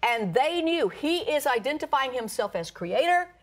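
high, emphatic preacher's delivery; high-pitched sermon proclamation; impassioned preaching. Acted. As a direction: drive each declaration home from the pulpit. Preaches to congregation.

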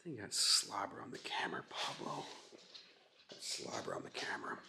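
annoyed under-breath whisper. Real, not acted. Annoyed aside about slobber.

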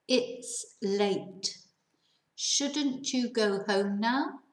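"Shouldn't you go home now" drawn out slowly, rising at the end; slow it down so learners catch every word; slow reading. Shown as a demonstration. Teacher reads the example slowly to students. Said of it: "voy a leerlo, lento".

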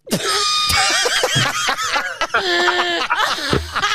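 big high shrieking laugh. Real, not acted. Prankster cracking up after the reveal, shrieking laugh. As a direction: explode into laughter as the prank lands.

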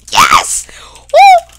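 very high, loud victory whoop; excited cheering. Real, not acted. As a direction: shriek with triumph. Lands the combo, celebrates.

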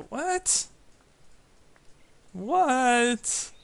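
stunned, rising what; shocked disbelief. Real, not acted. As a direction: cry out in disbelief. Sudden shock at game event.

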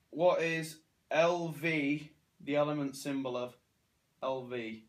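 usual speaking voice

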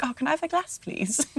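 put-on British accent. Imitating her English-born friend asking for a glass, British accent. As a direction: mimic a polite British request, long A in "glass". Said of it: "a little bit of a British accent".

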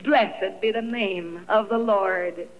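slow, low, stiff words of faith; stiff-lipped recitation. Retold or imitated. Widow at husband's deathbed, slow stiff scripture. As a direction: force the scripture out slowly through grief. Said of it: "my stiff lips saying".

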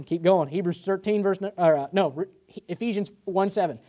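ordinary talk

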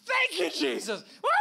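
high, loud praise with a whoop; hollered jubilant praise; imitated celebratory shout. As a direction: mimic a groom shouting thanks to heaven. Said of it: "celebrate and shout".

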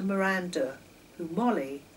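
ordinary delivery, nothing marked

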